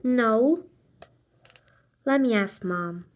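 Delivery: ordinary delivery, nothing marked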